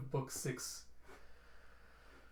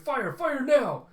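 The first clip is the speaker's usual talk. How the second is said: much higher and louder, slow barked order; commanding shout; 12 semitones higher, 12 dB louder, slower than usual. Acted. The queen ordering her ship to fire, shouting. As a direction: yell the firing order. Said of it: "Your Majesty's like".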